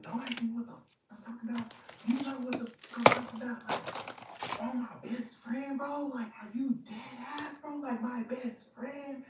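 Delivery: high, hammed-up impression of the furious boyfriend; mock boyfriend. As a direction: play him outraged and squeaky. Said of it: "then he's like".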